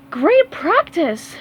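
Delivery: slow, high, loud cheer. Acted. Sandy cheering after a good practice, high and slow. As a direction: cheer the whole team. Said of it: "cheered Sandy".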